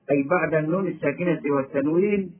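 ordinary delivery

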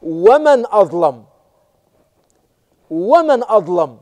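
slow, loud ومن أظلم; dripping reproach. Shown as a demonstration. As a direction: lean hard on ومن, slowly, scolding. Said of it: "يقطر تقريعا".

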